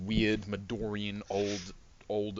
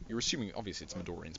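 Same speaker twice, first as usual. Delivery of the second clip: ordinary talk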